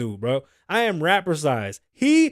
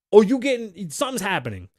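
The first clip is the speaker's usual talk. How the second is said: ordinary talk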